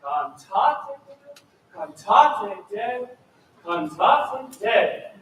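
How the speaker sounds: slow rhythmic Cantate Deo, pushing TA and DE; stressed repetition. Shown as a demonstration. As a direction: push the TA of Cantate and DE of Deo each time. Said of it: "repeat it three times in a row".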